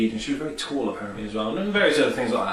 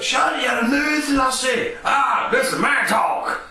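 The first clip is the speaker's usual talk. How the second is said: much higher, loud, slow shouted Scottish voice; Scottish Cait Sith; 4 dB louder, slower than usual. Retold or imitated. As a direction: bark it out like a shrill little Scottish cat. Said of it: "Cat Sith just harps up".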